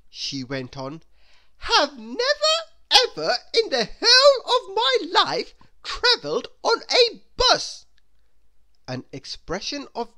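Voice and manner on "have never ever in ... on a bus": very high, loud, almost wailing indignation; a much higher, louder melodramatic whine; snobbish Miss Splendid. Acted. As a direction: overplay the horror of buses. Miss Splendid scorns the bus.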